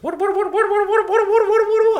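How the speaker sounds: very high squeal, repeated fast; squeaky repeated what. Retold or imitated. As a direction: rattle off whats in a high squeak. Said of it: "And Rory goes".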